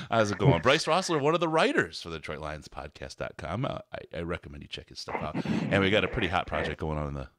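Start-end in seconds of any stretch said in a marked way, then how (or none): none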